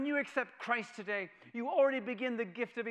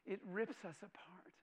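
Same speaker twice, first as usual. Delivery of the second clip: hushed whisper; 9 dB quieter than usual. Real, not acted. Preacher repeating death's cruelty, whispered. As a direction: breathe it out unvoiced.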